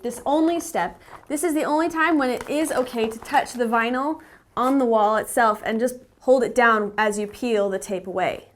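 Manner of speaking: ordinary delivery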